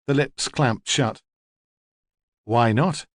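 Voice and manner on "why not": ordinary delivery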